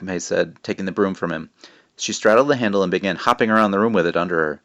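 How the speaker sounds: usual speaking voice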